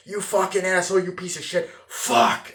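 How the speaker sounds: loud, high shouted insults; furious yell. Retold or imitated. Retelling DiCaprio yelling at the phone operator, furious yell. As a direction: blow up at the man on the phone. Reenacting DiCaprio's angry outburst.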